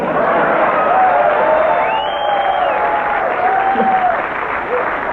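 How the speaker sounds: shrill mock laugh, far up; high-pitched cackle. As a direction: crack up in a squeal. Big cackle after punchline.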